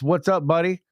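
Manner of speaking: ordinary talk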